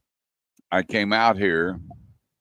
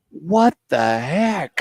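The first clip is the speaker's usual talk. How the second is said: high, slow mock wife voice; lightly voiced wife calling from the door; wife's startled question; 9 semitones higher, much slower than usual. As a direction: play the surprised wife, drawn out. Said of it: "and I hear".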